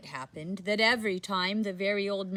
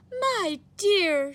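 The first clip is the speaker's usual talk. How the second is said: put-on high, loud old woman cry; 12 semitones higher, 6 dB louder than usual. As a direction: cry out in alarm, high-pitched. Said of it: "she cried".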